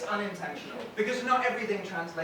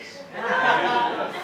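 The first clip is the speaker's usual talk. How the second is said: villain cackle; 3 semitones higher, 6 dB louder than usual. Retold or imitated.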